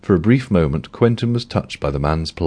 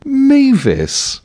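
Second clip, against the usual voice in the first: shocked cry, much higher and louder; astonished blurt; 5 dB louder than usual. Acted. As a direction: blurt her name in total astonishment. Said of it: "he blurted in astonishment".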